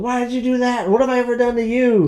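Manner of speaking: high, hurt, put-upon whine; mock whiny victim. Mimicking the villain's whine to her co-host. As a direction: play the wounded innocent. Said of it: "she's like".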